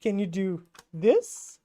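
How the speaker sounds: slow, lightly voiced mock challenge; a hint of PewDiePie, drawn out; PewDiePie impression. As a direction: tease like a YouTuber showing off. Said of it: "PewDiePie's like".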